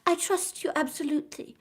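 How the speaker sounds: put-on young Suzanne whispering her trust; eager whisper. A narrator's reading. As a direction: breathe it earnestly to him. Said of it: "said Suzanne suddenly".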